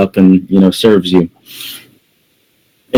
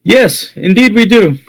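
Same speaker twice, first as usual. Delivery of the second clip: high-pitched tavern worker voice; Sergey's reply; 10 semitones higher than usual. Acted. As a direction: answer obligingly in a very high voice. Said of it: "He goes".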